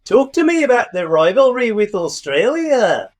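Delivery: New Zealand accent drifting toward Swedish at the end. Shown as a demonstration. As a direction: have a shaky go at a Kiwi accent. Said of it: "hear me do the New Zealand accent".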